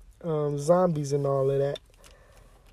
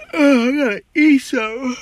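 higher, louder moan; whiny complaint; 10 semitones higher, 8 dB louder than usual. Real, not acted. Podcaster complaining he's hungry, whiny. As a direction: groan about being hungry.

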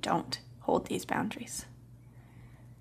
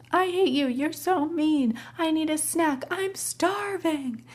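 put-on child tantrum; 9 semitones higher, slower than usual. Toddler tantrum at bedtime.

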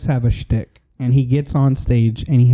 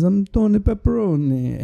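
higher, hammed-up Italian accent; bad Italian accent; 6 semitones higher than usual. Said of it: "he has this terrible Italian accent".

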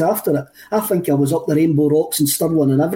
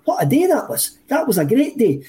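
excited; 6 semitones higher than usual. Retold or imitated. Recalls his own words, thrilled.

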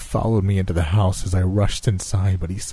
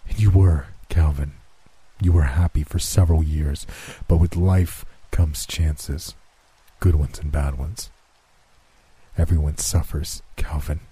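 lower, measured voice of Death; Death's voice; 4 semitones lower than usual. Acted. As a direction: speak as calm, cold Death. Death answers the grieving man.

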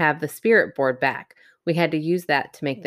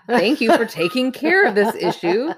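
mock grateful; 7 semitones higher, 3 dB louder than usual. Mock thanks to a demon.

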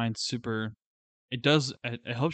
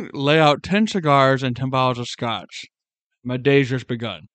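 mock Winston Churchill; 8 dB louder than usual. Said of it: "classic Bulldog Day right there".

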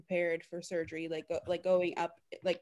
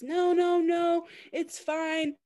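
much higher and louder, slow drawn-out no no no; mock denial; 10 semitones higher, 5 dB louder, much slower than usual. Patient brushing off staff asking if she is anxious, hammed-up high denial. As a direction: ham up insisting everything is fine. Mocking her own denial.

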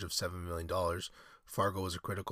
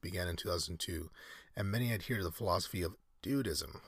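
normal talking voice

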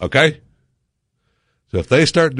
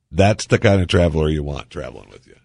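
ordinary talk, nothing marked

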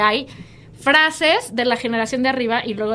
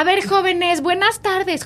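mock señora; 6 semitones higher than usual. Mocking how señoras greet youth.

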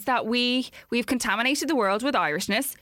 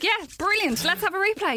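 mock delighted officials; 9 semitones higher than usual. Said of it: "They're like".

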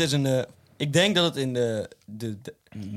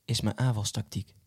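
slow, solemn poet recital; plechtige voordracht; 2 semitones lower, 6 dB quieter than usual. Pretend. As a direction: declaim it like a pompous poet. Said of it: "ik zal hem even hier voordragen, als een of andere poëet". (langzaam voordragen als een dichter)

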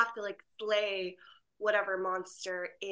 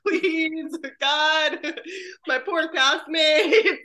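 laughing plea; 6 semitones higher, 8 dB louder, much slower than usual. Retold or imitated. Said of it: "I'm like".

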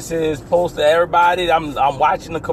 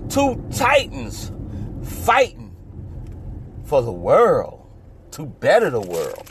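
movie trailer voice; 3 semitones lower than usual. Acted. Dramatic fight promo.

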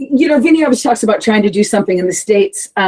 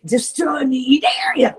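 breathy whispered hiss of the words; whispered lyric; 5 semitones higher, 4 dB quieter, slower than usual. Retold or imitated. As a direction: whisper it like a taunt. Quoting the song's lyric.

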